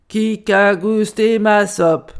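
loud, slow big-bear roar; big bear's outcry. A narrator's reading. Grand Ours finds his soup tasted, loud indignant outcry. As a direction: boom it out word by word. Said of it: "s'escria Grand Ours".

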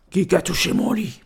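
growly big-bear voice; big bear's outcry. A narrator's reading. Grand Ours finds his bed touched, growled outcry. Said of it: "s'escria Grand Ours".

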